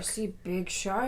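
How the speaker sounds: soft, low mumbled imitation; Tommy. Imitating a man in a boat spotting something, soft and low. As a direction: lightly imitate him, hushed and low. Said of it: "Tommy goes".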